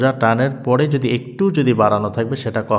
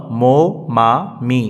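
long held vowels, one syllable at a time; drawn-out, rhythmic three-syllable drill; slow syllable drill; slower than usual. Shown as a demonstration. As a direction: recite slowly for pupils to repeat. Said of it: "তাহলে কি হলো?".

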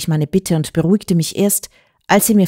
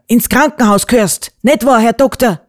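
loud, high, emphatic ruling; shouting mayor; 6 semitones higher, 5 dB louder than usual. A narrator's reading. Mayor ordering the girl to hospital, loud shout. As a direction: raise the voice and hammer it out. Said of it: "schrie der Bürgermeister".

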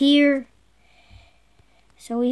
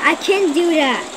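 ordinary delivery

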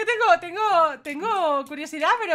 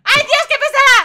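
high, loud alarmed outburst; loud, exasperated alarm; playful panic; 9 semitones higher, 12 dB louder than usual. Pretend. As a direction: shout in mock panic as she closes in. Pursued by a pestering stranger.

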